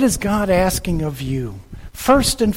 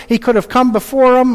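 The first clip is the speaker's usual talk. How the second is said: ordinary talk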